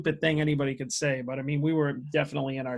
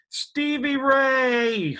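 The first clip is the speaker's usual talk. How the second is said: high, drawn-out crowd yell; mock yell; 10 semitones higher, 4 dB louder, much slower than usual. Teens yelling a name at a concert, slow high yell. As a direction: stretch the name out like a fan screaming. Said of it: "like, yelling".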